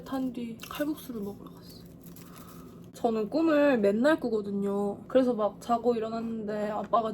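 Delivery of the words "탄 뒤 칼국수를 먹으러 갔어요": punchline whispered; deadpan whispered payoff. A narrator's reading. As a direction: softly whisper the absurd ending. Anticlimactic ending.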